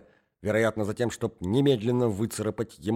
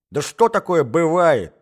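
exasperated yell; 6 semitones higher, 7 dB louder than usual. A narrator's reading. Exasperated hostess yelling.

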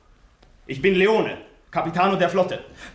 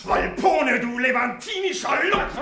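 captain storms in to stop the assault, furious shout; 2 semitones higher than usual. Acted. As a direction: thunder it at the villain. Captain bursts in.

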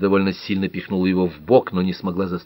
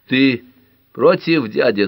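higher, louder challenge; belligerent; 6 semitones higher, 5 dB louder, slower than usual. A narrator's reading. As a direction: throw it down as a challenge. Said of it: "сказал Дэви воинственно". (воинственно, с вызовом)

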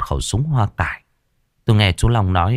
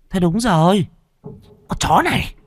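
high, shrill sudden realization; much higher, rushing triumphant accusation; excited; 11 semitones higher than usual. Acted. Woman recognizes escaped captive.

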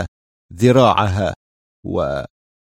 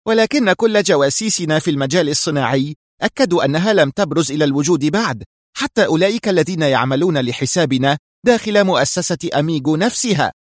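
higher-pitched sharp retort; 6 semitones higher than usual. A narrator's reading. Sonia argues with her boss about their spies, sharply. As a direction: push back sharply, voice raised in pitch. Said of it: "قالت في حدة".

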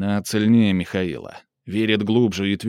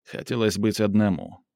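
plain reading voice

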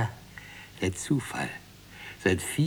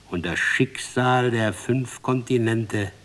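usual speaking voice

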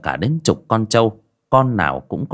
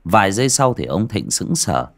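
ordinary delivery

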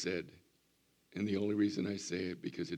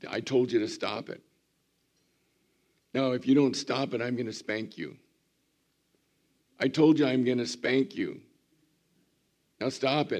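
rising, louder scolding threats; mock a parent losing patience; exasperated parent; 5 semitones higher, 7 dB louder than usual. Preacher imitates a fed-up parent scolding a child, loud and rising. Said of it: "you say".

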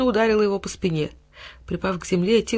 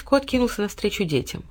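plain reading voice

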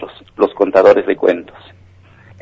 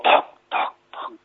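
hollow "toc" fading away; soft, voiceless, receding knocks; stone knocking; 10 dB quieter than usual. Acted. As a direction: imitate a dropped stone echoing down a cave. Imitates stone bouncing away in cave.

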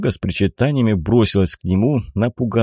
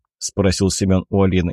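plain reading voice

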